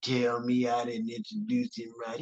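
low, disbelieving grumble. Retold or imitated. Honoree complaining to seatmate about introduction, low grumble. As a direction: imitate a man griping low into someone's ear. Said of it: "He's, like, right near my ear".

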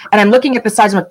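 ordinary delivery

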